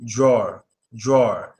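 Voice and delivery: "drawer" low and falling, tɹɔɹ; just say "drawer" like draw; simple drawer. Shown as a demonstration. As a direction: model the easy "drawer". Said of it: "all you have to say is drawer".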